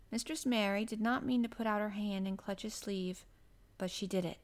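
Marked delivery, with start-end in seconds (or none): none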